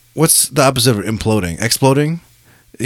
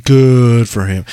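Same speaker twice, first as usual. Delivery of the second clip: mock emphatic; 6 dB louder, slower than usual. Repeated, exaggerated second take.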